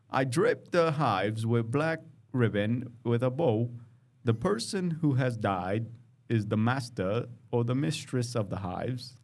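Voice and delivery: self-conscious attempt at a British accent reading the beekeeper's words. Shown as a demonstration. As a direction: try on a British accent for the quote, not quite landing. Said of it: "I should say that in a British accent".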